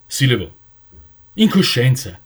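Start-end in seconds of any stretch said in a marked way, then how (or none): 1.3-2.2 crying out louder to his brothers; shouted. Acted. Jonathan cries out against the council, loud and indignant. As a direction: shout the protest across the assembly. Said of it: "gridò".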